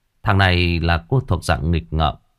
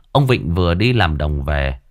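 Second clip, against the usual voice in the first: ordinary delivery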